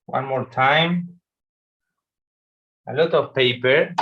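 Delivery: normal talking voice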